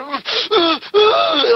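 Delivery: high-pitched mock sobs; imitated crying. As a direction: wail in short, hammed-up crying bursts. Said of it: "he's crying".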